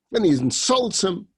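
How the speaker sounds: ordinary delivery, nothing marked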